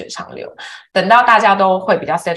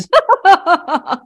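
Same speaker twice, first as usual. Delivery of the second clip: bright laugh, higher and louder; high burst of laughter; 12 semitones higher, 6 dB louder than usual. Real, not acted. Laughing while telling a friend about childhood. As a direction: laugh out loud at the memory.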